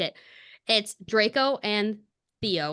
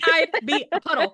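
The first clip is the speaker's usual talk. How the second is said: higher, louder gushing repeat; giddy, louder swoon; excited swoon; 8 semitones higher, 7 dB louder than usual. Real, not acted. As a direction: gush it like you're melting.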